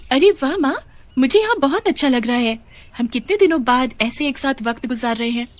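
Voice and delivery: put-on high, happy little girl voice; a child thrilled to be out with mum; cheerful young daughter. Daughter tells her mother she loves the walk, high and happy.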